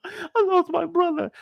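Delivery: high, slow fake weepy wail; hammed-up high-pitched sobby whine; mock crying. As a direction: imitate a woman tearfully playing victim. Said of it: "she starts crying".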